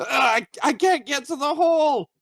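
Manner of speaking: high, straining little voice; struggling gnome. Acted. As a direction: squeal helplessly against the current. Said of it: "He is not very strong".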